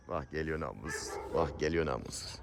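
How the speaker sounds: very soft, low murmur; hushed aside. Real, not acted. Owner spots the rascal coming, hushed low aside. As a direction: drop right down to a private aside. Aside as the called animal comes.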